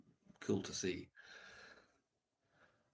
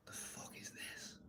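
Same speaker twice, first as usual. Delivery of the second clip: slow, breathy whisper of dismay; whispered disgust; 15 dB quieter, slower than usual. Retold or imitated. Reading his old poems by the fire: slow whispered disbelief. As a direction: mutter it under your breath at your own poem.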